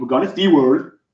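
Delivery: lightly voiced Kermit-like impression; a hint of Kermit the Frog; Tim Tracker. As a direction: imitate a vlogger who sounds like a Muppet. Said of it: "an impression of Tim Tracker".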